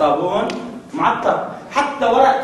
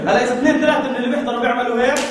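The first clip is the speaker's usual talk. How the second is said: normal talking voice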